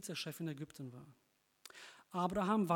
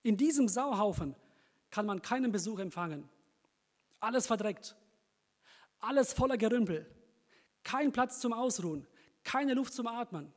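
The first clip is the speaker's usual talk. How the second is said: loud rant; 4 semitones higher, 6 dB louder than usual. Acted. Man rants about his messy house.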